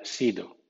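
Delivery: "sido" with a soft dental Spanish D; plain Spanish "sido". Shown as a demonstration. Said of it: "la D española".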